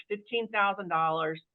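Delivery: normal talking voice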